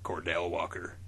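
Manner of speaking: low, soft tough guy; Walker voice. Acted. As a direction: growl the name low. Walker answers gravely.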